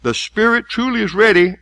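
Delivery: preacher intoning the words of Jesus loudly; preaching at full force. Acted. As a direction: declaim the scripture with weight.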